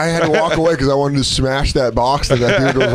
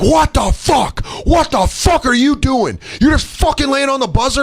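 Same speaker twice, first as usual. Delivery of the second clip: furious shouting; 14 semitones higher than usual. Retold or imitated. Customer confronting driver about buzzer, furious high shouting. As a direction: holler in outrage at the driver.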